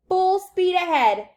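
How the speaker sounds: high, drawn-out holler; shouted command. A narrator's reading. Wonka urging the rowers onward, shouting. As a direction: call it out slow and loud. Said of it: "shouted Mister Wonka".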